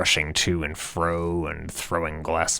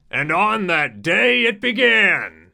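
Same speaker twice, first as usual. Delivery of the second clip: much higher, louder, slow grand announcement; epic proclamation; 12 semitones higher, 5 dB louder than usual. A narrator's reading. Narrator opening a saga, slow grand proclamation. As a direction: proclaim it like a movie trailer.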